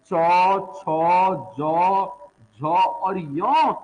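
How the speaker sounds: चौ, छो, जो, झो stretched out very slowly; teacher spelling out चौ and यो very slowly for notes; slow Hindi demo. Shown as a demonstration. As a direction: drag out each of चौ छो जो झो. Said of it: "हिंदी में".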